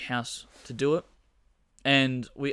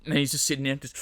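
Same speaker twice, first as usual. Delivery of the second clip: ordinary talk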